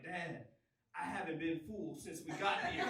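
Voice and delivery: low, subdued imitation of his kid; his young son. Son confessing hunger to dad, understated imitation. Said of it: "he was like".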